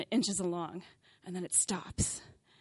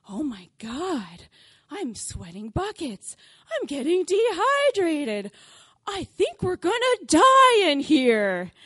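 whiny tourist voice, slow, building from normal to high and loud; complaining tourists getting louder; 5 semitones higher, 10 dB louder, slower than usual. Retold or imitated. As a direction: drag it out, rising into a loud moan. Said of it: "start complaining and complaining louder and louder".

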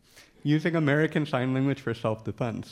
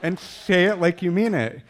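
forceful instruction; 4 semitones higher, 7 dB louder than usual. Shown as a demonstration. Teaching deaf students to mean it.

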